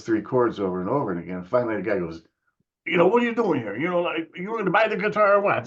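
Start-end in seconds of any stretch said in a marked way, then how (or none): 2.9-5.7 high-pitched imitation of a fed-up seller; exasperated shopkeeper. Guitar seller to kid playing three chords, exasperated imitation. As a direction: mimic a vendor at the end of his patience, voice way up.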